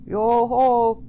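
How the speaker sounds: loud, high-pitched call; distant hail. A narrator's reading. Joe hailing the couple from afar, calling out. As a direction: holler it out to carry down a path. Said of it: "came Joe's voice from far down the path".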